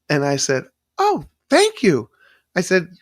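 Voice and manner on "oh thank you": much higher, slow, gushing thank you; delighted surprised thanks. Retold or imitated. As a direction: gush over an unexpected present.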